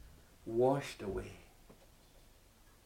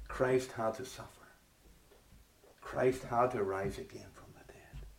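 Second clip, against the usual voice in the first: soft, unvoiced whisper; 2 semitones lower, 8 dB quieter than usual. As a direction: breathe it out intimately. Hushed sermon point.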